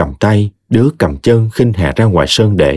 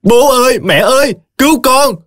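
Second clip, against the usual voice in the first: terrified cry for help. A narrator's reading. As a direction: scream for your parents as you're beaten. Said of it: "Nó gào lên kêu cứu". (gào lên kêu cứu hoảng loạn, giọng rất cao)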